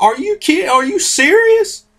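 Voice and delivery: high, slowed disbelief; incredulous. Retold or imitated. Friends hearing about the comics, high disbelief. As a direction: stretch out the shock up high. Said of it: "They're like".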